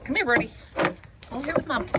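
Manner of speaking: high-pitched doggy talk; high coaxing pet voice. Real, not acted. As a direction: call the dog in a high sweet voice. Calling the dog over.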